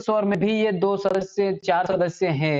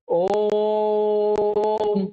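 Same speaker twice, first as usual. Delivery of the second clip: ओम called out long and raised; priest calling Om; 3 semitones higher than usual. Retold or imitated. Teacher imitates a priest calling at the gate, drawn-out ओम. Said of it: "कैसे चिल्लाता है?".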